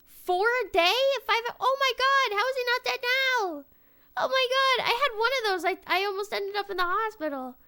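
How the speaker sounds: high, loud disbelief; shocked outburst. Real, not acted. Reacting to four energy drinks a day, shocked and loud. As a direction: exclaim in alarmed astonishment.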